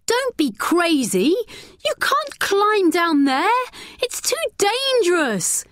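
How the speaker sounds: high, slow, urgent warning; frightened girl pleading with her friend; alarmed warning. A narrator's reading. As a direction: stop her friend from climbing down. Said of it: "said Natalie".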